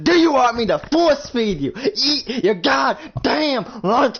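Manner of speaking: high, loud, slow furious bellow; angry shout. Retold or imitated. Connor screaming at Nathan to eat his lunch, furious. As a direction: roar the threat, dragging it out. Force-feeding threat.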